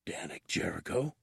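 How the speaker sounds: hushed, low, wary question; cautious whisper. A narrator's reading. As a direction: drop right down and ask it carefully. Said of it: "dropped to a cautious whisper".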